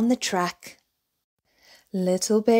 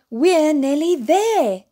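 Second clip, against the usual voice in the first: slow, higher reassuring lift; encouraging; 6 semitones higher, 5 dB louder, slower than usual. A narrator's reading. Daddy encouraging little bear that they are nearly there, slow and warm. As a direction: cheer him on gently.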